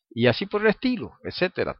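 ordinary delivery

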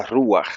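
ruach stressed on the first syllable, RÚ-aj. Shown as a demonstration. Teacher correcting the brethren, ruach stressed on its U. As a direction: lean on the U in ruach, letting the A fall away. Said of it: "con acento en la U".

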